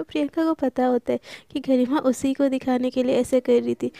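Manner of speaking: ordinary delivery, nothing marked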